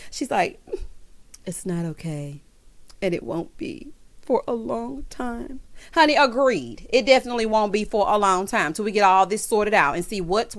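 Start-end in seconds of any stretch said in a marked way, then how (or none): 1.4-5.6 slow, soft, drawn-out dramatic delivery; quietly milk the drama, mocking her; dramatic Erika impression. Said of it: "slow and dramatic".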